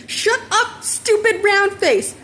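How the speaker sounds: high, slow-stretched furious shout; angry yell. Acted. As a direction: blast the insult back at her. Bakugo yells at teasing girl.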